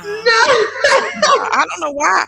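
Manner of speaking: loud, high words through laughter; laughing disbelief. Real, not acted. Storyteller puzzles over being turned down, laughing disbelief. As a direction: protest loudly while laughing. Laughing at rejection of her offer.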